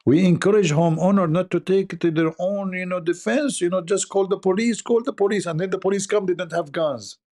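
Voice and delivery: lightly mock the police statement; mocking official advice. Parodying police advice to homeowners, sarcastic and high.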